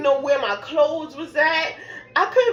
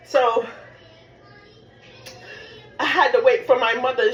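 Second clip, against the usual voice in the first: ordinary delivery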